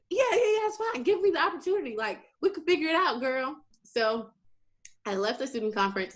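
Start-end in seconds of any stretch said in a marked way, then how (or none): 0.1-3.6 pitched way up, eager and pleading; quick, bright reassurances to the interviewer; high eager reassurance. Retold or imitated. Telling the interviewer it's fine, pitched way up and eager. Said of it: "I'm like".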